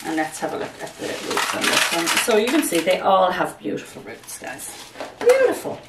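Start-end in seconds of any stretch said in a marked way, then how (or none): 5.2-5.8 a high, loud gush of delight; delighted exclamation. Real, not acted. Showing viewers the roots, a high, loud gush of delight. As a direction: swoon over the roots, much higher and louder. Admiring her plants' roots.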